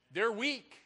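usual speaking voice

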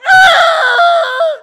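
piercing high mock scream; a startled shriek at the police; driver's startled high scream at a policeman. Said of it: "You go".

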